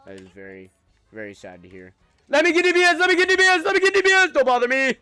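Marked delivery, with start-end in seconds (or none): 2.3-4.3 very high, loud, repeated shouting; excited shout. Real, not acted. Gamer demanding a character from friends, shrill repeated shout. 4.3-5.0 playful shout. Real, not acted. Shouting during game.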